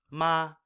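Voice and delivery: "ma" held high and level; first-tone "ma", sung-out high and steady. Shown as a demonstration. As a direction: keep "ma" high without veering. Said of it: "you're starting high, staying high".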